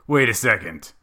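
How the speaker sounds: loud retold double-take; startled realization. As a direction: blurt it out as if stopped short. Retelling a sudden recognition mid-match.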